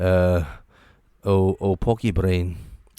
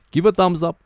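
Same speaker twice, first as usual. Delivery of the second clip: loud call; 10 semitones higher, 6 dB louder than usual. Real, not acted. Vlogger urging viewers to like the video, higher and louder. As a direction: Give a thumbs up, higher and louder.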